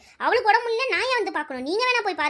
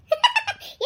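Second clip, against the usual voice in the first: high laugh. Real, not acted. Sister laughs at crooked sister.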